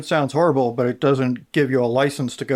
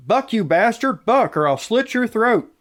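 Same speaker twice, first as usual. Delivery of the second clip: higher, half-hearted reading of a killer's threat; no Australian accent, just a pitched-up reading; reluctant quote read; 10 semitones higher than usual. Retold or imitated. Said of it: "There was no Australian accent with that".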